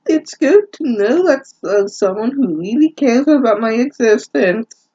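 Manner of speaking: high, hammed-up lovesick girl; mock Reichu voice. Reader voicing Reichu's grateful line to Denmark, high mocking coo. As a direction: coo it like a swooning fangirl.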